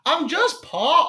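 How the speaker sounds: high, slow, badly faked British voice. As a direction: draw it out in an amateur British impression. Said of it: "this is my impression".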